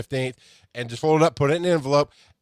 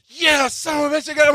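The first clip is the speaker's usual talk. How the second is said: yelled much higher, a cheer of triumph; triumphant yell; 12 semitones higher than usual. Retold or imitated. Buyer cheering to himself after landing the sale, high triumphant yell. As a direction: whoop it out like you just won. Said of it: "I'm like".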